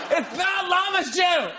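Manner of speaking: high, drawn-out laughing-game protest; exasperated shout. Real, not acted. Host rejecting guest's wrong guess, exasperated shout. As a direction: shout it high, stretching the words.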